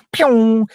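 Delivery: high-pitched "pew" sound effect; high zooming whoosh. Acted. Mimicking a character racing off for ice cream, zooming noise. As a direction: imitate something shooting off at speed, high. Imitating someone shooting off fast.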